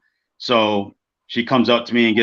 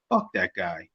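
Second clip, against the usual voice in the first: low, quiet contempt; flatly serious, under the voice; dead serious; 5 semitones lower, 8 dB quieter than usual. Retold or imitated. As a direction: retell a deadpan dismissal, dropped low and soft. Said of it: "dead serious".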